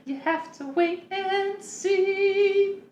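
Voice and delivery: high sing-song teasing, slow; lilting giggle then tease; lion cub. Acted. GM voices NPC teasing.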